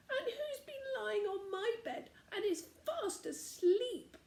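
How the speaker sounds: very high, tearful-laughing squeak; squeaky baby bear. A narrator's reading. Baby bear finds a sleeper in his cot, squeaking high. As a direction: squeak it like a tiny excited cub.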